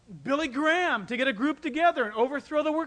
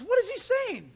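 high, surprised ask; startled question; 11 semitones higher, 3 dB louder than usual. Retold or imitated. Missionary startled by the chief's yell, high question. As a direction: pitch it up in alarm.

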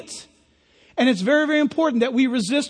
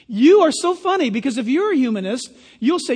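normal talking voice

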